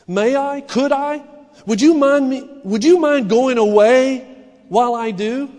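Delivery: high, loud, anxious begging; scared pleading girl. Acted. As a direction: plead nervously with a lion. Frightened girl pleading with lion.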